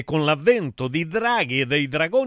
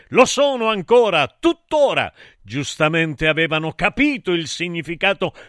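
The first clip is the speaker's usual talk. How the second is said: ordinary delivery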